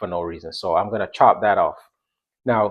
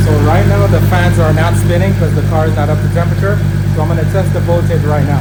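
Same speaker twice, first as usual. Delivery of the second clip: raised voice over engine; 6 semitones higher, 9 dB louder, faster than usual. Real, not acted. Talking over a running engine.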